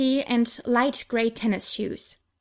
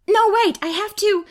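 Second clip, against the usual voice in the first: much higher, loud panicked plea; alarmed protest; 10 semitones higher, 7 dB louder than usual. A narrator's reading. Angel cut off as Peter grabs her, panicked. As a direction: blurt out a desperate stop.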